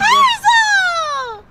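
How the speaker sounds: very high, drawn-out squeal; long, high pet call; cooing call. Real, not acted. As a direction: call the dog in a squeally coo. Calling the dog in baby talk.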